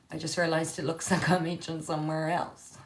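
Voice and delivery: ordinary delivery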